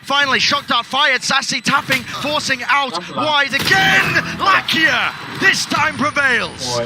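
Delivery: building to a drawn-out shouted climax; excited play-by-play. Real, not acted. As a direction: stretch out the winning call. Caster builds to round win.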